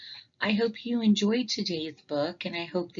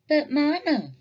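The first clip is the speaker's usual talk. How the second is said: protesting; 9 semitones higher, 3 dB louder than usual. Acted. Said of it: "protested Sister".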